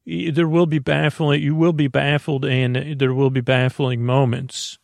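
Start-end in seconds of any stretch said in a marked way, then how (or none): none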